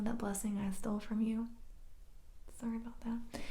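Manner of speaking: very soft, near whisper; mock sheepish apology. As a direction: let the apology shrink to a mumble. Jacob apologizes for stolen blessing.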